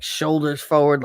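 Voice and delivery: ordinary delivery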